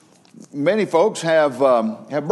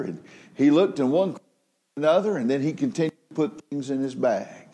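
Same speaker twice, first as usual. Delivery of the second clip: ordinary talk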